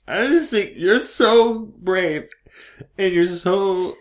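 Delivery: very high, loud, over-the-top admiration; mock gushing. Guest pretends to adore host, mock gushing. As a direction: gush it way up high, hammed-up.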